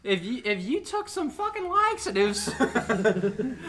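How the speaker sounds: high, threatening scold through laughter; mock angry mom. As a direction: mom threatening over laxatives. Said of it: "She was like".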